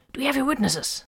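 high-pitched, tense comm voice; tense high voice over comm; urgent officer's question. A narrator's reading.

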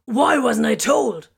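outraged. A narrator's reading. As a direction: demand it in outrage. Corvin outraged.

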